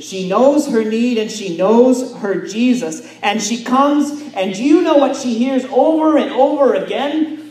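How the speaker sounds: full-force preaching. Acted. Pastor urging the congregation, in a loud ringing preach.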